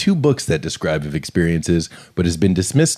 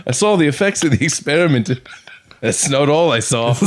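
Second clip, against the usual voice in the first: mock British accent, pitched up; a Boris Johnson-style British voice; 4 semitones higher than usual. Imitating the witness in mock British accent. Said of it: "You sound like Boris Johnson".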